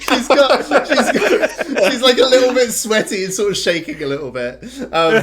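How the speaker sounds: high, loud laughing talk. Real, not acted. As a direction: laugh your way through the words. GM cracking up describing.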